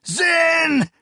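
very high shrieked name; screech. Acted. Akamian seeing the knife rise over his friend, screeching his name. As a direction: scream his name in horror. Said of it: "Akamian screeched".

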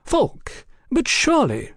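high-pitched pretended disbelief; mock-shocked protest, pitched very high; feigned shock. A narrator's reading. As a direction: play scandalized and incredulous. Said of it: "Oliphant feigned shock".

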